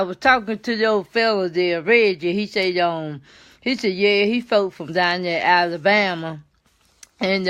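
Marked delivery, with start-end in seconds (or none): none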